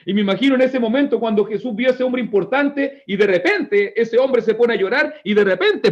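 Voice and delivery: ordinary talk, nothing marked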